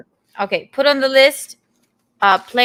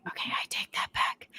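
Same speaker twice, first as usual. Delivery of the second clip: whisper; 14 dB quieter than usual. Real, not acted. Retracting with a whisper.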